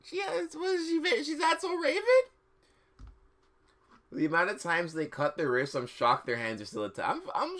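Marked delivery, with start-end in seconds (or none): 0.0-2.0 incredulous. Real, not acted. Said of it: "mocking the story".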